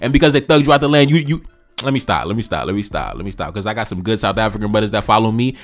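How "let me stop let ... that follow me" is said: calming himself down. Real, not acted. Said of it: "I be getting passionate".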